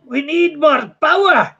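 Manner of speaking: urgent starship crew call. Acted. Crew member calling to captain.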